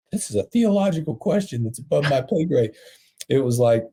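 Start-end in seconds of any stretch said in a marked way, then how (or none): 0.1-2.7 higher-pitched mock dodge; mock hesitant excuse. Preacher imagining himself stuck for an answer, mock excuse. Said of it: "I wasn't stuck there going".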